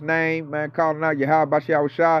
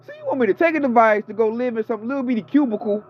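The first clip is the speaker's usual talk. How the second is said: indignant rant; 6 semitones higher than usual. Retold or imitated. Telling off Esau directly.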